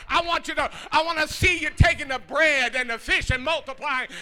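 sermon climax shouted with music; climactic preaching. Acted. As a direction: preach it to the rafters. Preaching at full force.

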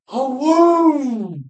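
high, loud hunter's whoop; imitated excited whoop.

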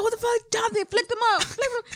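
high, urgent mock yell at her brother; mock road rage. Yelling at brother John to flip off driver, mock rage. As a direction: egg him on in mock rage. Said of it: "I was like".

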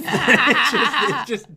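high, loud burst of laughter over words; laughing while talking. Real, not acted. Comedian cracking up at his own sketch idea.

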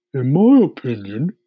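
high, loud earthworm voice. A narrator's reading. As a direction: pronounce it as an opinionated earthworm. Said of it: "the earthworm said".

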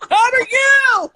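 very high, slow shouted greeting. Real, not acted. Guest hollering hello to the host, loud. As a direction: sing out the hello at full volume.

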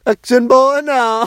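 mock movie cry. Quoting movie song.

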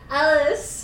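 high, pained, rising call; moan. A narrator's reading. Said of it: "I moaned".

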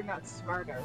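usual speaking voice

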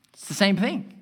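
ordinary delivery

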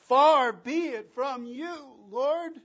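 loud, high and slow, full of passion; passionate cry. Acted. Peter correcting his Lord, loud impassioned plea. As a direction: cry it out in anguished devotion. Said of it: "we can just hear the passion in his voice".

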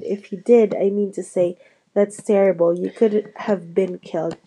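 ordinary delivery, nothing marked

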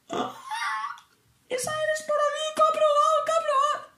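very high, sing-song cries of joy; sob-squeal with happiness; ecstatic tears. Retold or imitated. Student celebrating passing her test, ecstatic high sing-song cries. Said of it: "Llorando de la emoción".

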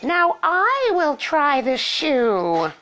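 very slow, high, stretched cry; high, drawn-out sisterly wail; yelling ugly sister. A narrator's reading. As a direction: yell it as a grotesque sister, dragging each word. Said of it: "who yelled".

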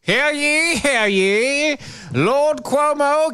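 booming herald's call; town crier. Pretend. Mock royal proclamation of Cuomo.

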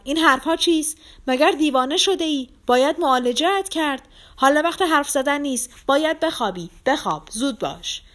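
high, loud alarmed scolding; horrified shout. A narrator's reading. Lizaveta orders the sick man to sleep, horrified shouting. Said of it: "با وحشت داد زد".

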